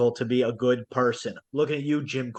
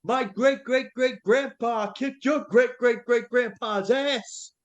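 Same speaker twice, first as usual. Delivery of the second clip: much higher, slowed, hammed-up yell; American fan heckle; 9 semitones higher than usual. Friend suggesting a heckle for the English goalie, hammed-up gloating. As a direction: gloat like a patriotic superfan. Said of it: "if you went".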